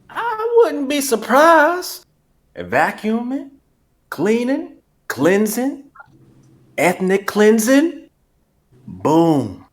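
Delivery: put-on drawn-out country rooster accent, high rising ends; slow, stretched cartoon country accent; Foghorn. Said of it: "I felt like I was being Foghorn".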